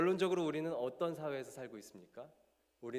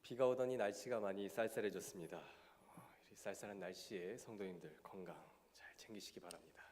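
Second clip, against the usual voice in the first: stuffy nasal voice from a cold; talking through a blocked nose; 4 semitones lower than usual. Real, not acted. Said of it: "제가 코맹맹이 소리로 얘기하니까". (코맹맹이 소리)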